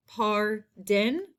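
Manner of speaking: "par... den", split and rising at the end; syllable by syllable. Shown as a demonstration. Teacher sounding out a spelling word, "Pardon" split in two. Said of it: "first syllable".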